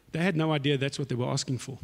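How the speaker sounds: normal talking voice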